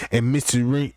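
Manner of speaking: usual speaking voice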